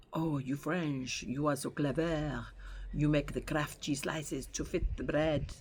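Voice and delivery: slow, mock French-accented English; broken-English French accent. Partner proudly quoting his one line, in a broken French accent. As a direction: imitate a Yorkshireman's laboured French accent. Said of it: "in his broken English French accent".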